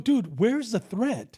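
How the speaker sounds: high, drawn-out challenge; incredulous challenge. Retold or imitated. Officer challenges cop three weeks later, incredulous question. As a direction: stretch the question out in disbelief.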